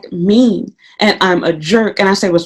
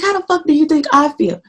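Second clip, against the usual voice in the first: inner exasperation; 8 semitones higher than usual. Retold or imitated.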